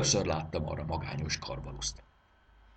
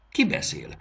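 sudden, higher and louder startled cry; startled question; 9 semitones higher, 6 dB louder than usual. A narrator's reading. Raxis startled by a voice from nowhere, a sharp high question. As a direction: jump at the unseen voice.

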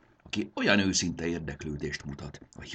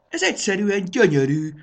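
much higher, louder amazed cry; delighted exclamation; 10 dB louder than usual. Acted. Dragon girl cries out at the train-filled house, thrilled. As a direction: gasp out your delight. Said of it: "kiáltotta Sári".

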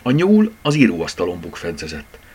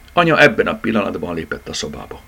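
plain reading voice

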